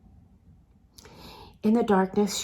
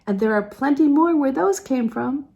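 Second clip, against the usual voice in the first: amused; 7 semitones higher, 5 dB louder than usual. A narrator's reading. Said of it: "Sebastian laughed".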